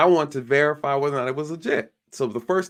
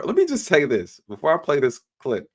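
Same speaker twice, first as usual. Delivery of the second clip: ordinary talk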